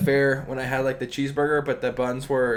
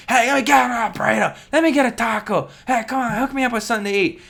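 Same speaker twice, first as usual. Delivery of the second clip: high, loud mock yelling at a drive-thru; homeless guy yelling; 8 semitones higher, 4 dB louder than usual. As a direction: ham up a hungry street guy hollering his order.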